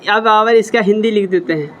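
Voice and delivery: ordinary talk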